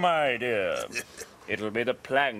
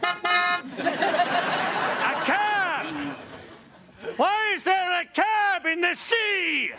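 baffled shout; 9 semitones higher than usual. Acted. Actor baffled on stage.

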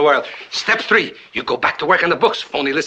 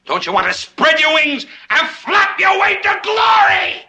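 building to a high shout; high theatrical rallying call; rousing exhortation; 14 semitones higher, 3 dB louder than usual. Acted. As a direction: swell into a grand, soaring cry. Rousing the timid accountant.

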